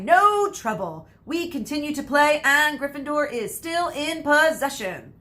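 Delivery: loud, high play-by-play; sports commentary. Acted. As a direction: call the match like a commentator.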